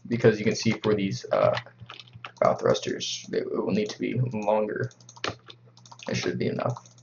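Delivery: hushed so nobody wakes; quiet at night. Real, not acted. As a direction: keep it down, people sleeping. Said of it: "I gotta be quiet".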